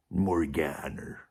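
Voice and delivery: low, slow, drawn-out film-star voice with a stuck-on chin; Kirk Douglas impersonation. Pretend. Vlogger repeats his Kirk Douglas with a fake chin, low and slow. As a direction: growl the name out slowly. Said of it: "Do you want to see it again".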